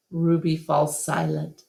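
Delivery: ordinary delivery, nothing marked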